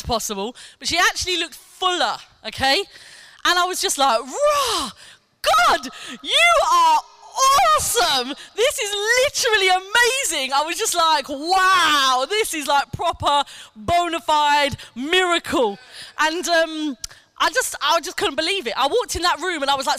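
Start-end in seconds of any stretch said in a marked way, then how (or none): 4.2-10.5 amazed exclaiming. Retold or imitated. Seeing the healed woman. 11.3-15.8 amazed exclaiming. Retold or imitated. Seeing the healed woman.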